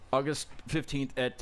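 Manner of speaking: usual speaking voice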